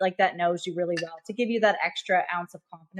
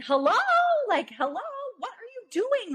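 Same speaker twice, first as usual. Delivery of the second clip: mock alarmed indignation. Startled seller to agents coming into her house, mock indignant. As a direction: call out as if catching intruders, mimicking her. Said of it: "as if we're breaking into their house".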